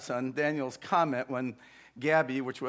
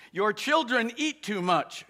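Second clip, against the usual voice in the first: raised-pitch grumbling complaint; mild grumble; 6 semitones higher than usual. A narrator's reading. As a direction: complain lightly as a storybook stepmother. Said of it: "she grumbled one day".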